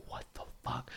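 voiceless awed what the fuck; stunned whisper. Retold or imitated. Fan amazed by nonstop zingers, voiceless whisper. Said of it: "I was like".

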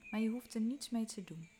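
ordinary delivery

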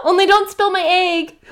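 high, loud cheery warning; cheerful. Acted. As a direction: keep it carefree and bright. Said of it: "Esteban answered cheerfully".

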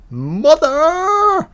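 high yelled call for mother; hammed-up high yell. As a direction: shout up the stairs, held long. Calling out like Nancy.

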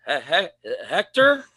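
hesitant, rising call to a dog; cautious, questioning dog call; tentative calling. Retold or imitated.

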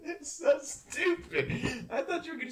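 laughing, high and drawn out. Real, not acted. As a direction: burst out laughing mid-sentence. Laughing after losing the round.

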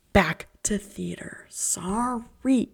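unvoiced hushed aside; whisper aside. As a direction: whisper it like a secret.

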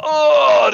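loud, high groaning cry; acted cry of pain; imitated pained groan. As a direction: groan loudly like someone hauling himself up. Imitates man hit, getting up.

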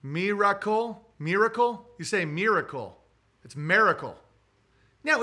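normal talking voice

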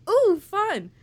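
high, loud oblivious cooing; mock enthusiasm. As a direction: imitate a friend's clueless delight. Mimics friend's oblivious enthusiasm.

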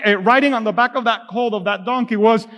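ordinary talk

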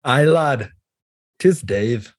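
brief put-on Scottish greeting; playful fake Scottish accent; mock Scottish accent. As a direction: introduce yourself as a pretend Scotsman. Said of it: "I can't do that accent".

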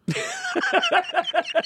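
high, sudden laugh; burst of laughter. Real, not acted. Comedian cracking up at his own cancer joke.

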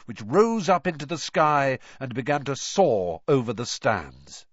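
ordinary delivery, nothing marked

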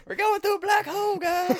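very high, loud falsetto; Daisy. Acted. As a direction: squeal the alert in a piercing cartoon voice. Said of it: "I think Daisy would tell us that".

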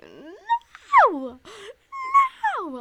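high, loud wailing "No! No!"; loud high mock wail; anguished no. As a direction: cry out in comic despair. Sees the charge go through.

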